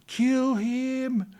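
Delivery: very high, very loud mob shout; mob yell. Retold or imitated. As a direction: scream it like the crowd pounding on the car. Crowd banging on the car.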